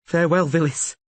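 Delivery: high, loud resolute goodbye; grim farewell. A narrator's reading. Rayla bidding dying Vilis a grim farewell. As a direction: say goodbye before the killing blow. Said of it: "she said".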